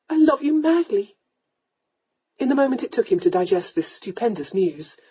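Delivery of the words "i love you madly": high, fervent declaration; crying out. A narrator's reading. Young lady confesses love to the lord, fervently. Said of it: "she cried".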